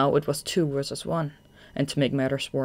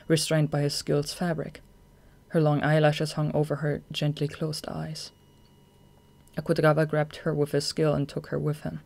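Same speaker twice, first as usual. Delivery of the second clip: ordinary delivery, nothing marked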